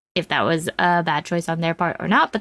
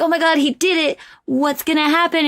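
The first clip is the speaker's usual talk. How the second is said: higher, eager viewer reaction; retold excitement; 7 semitones higher than usual. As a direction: voice the thrilled suspense, pitched up. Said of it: "You're excited with him".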